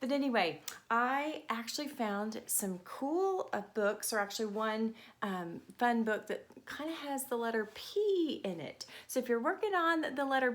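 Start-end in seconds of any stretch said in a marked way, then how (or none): none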